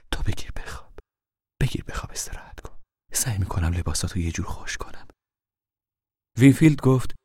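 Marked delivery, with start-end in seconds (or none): 0.1-5.1 soft whisper; hushed soothing. A narrator's reading. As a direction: lull her to rest. Mother settles daughter to sleep. (مادر آهسته و آرام با دخترش)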